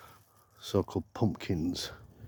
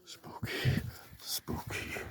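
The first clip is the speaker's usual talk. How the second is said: hushed, eerie whisper; playful ghostly whisper; spooky whisper; 11 dB quieter, much slower than usual. Pretend. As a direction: whisper it to creep them out. Spooking viewers in haunted forest.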